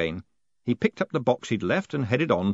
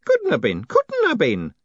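loud protest, climbing higher on the repeat; 6 semitones higher, 5 dB louder than usual. A narrator's reading. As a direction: insist it's impossible, louder and rising. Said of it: "Groat protested".

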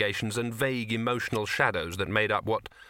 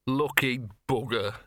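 sighing; slower than usual. Acted. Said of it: "sighed Gaspode".

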